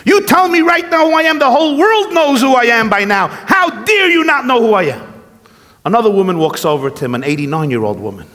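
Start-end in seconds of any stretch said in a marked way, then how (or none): 0.1-5.1 outraged shout. Retold or imitated. Rabbi telling a joke, Trump outraged at old woman, high shouting rant. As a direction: rage at her for not knowing him. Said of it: "He's outraged".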